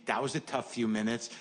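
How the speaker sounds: ordinary delivery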